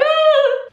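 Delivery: very high-pitched squeal; mock panicked squeal. Retold panicked reaction to dad's call.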